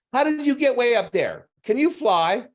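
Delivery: put-on high child voice, full of wonder; curious little boy. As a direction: pester with questions like a four-year-old.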